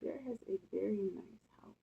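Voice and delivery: ordinary delivery, nothing marked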